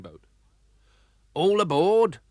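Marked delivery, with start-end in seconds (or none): 1.3-2.2 high, loud call. Acted. Captain Bumblebee summoning the family aboard, a loud high call. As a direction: hail everyone onto the boat. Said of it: "called Captain Bumblebee".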